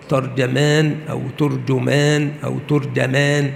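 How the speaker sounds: ترجمان as "tarjamaan", then "turjumaan", then "turjamaan"; vary the vowels on ت and ج in each ترجمان: a-a, u-u, u-a; three accepted vowellings. Shown as a demonstration. Teacher summing up valid forms, ترجمان as tarjamaan, turjumaan, turjamaan. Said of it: "وعلى هذا فلا يغلط أحد".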